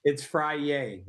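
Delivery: Friday said as fry-YAY, dropping the d; playful pronunciation. Real, not acted. Guest answers host's Friday remark, playful pronunciation. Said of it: "I like that".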